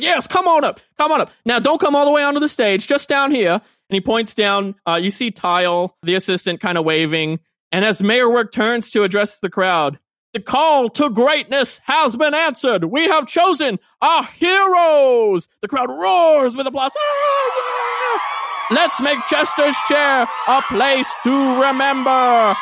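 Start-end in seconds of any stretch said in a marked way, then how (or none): 0.0-3.5 higher, quick bustling mayor; mayor's directions. Acted. Mayor steering Jeremiah just below the stage, bustling. 10.3-22.5 high, slow rallying cheer; proclaiming to crowd. Acted. Mayor announcing heroes to a roaring crowd, slow cheering call.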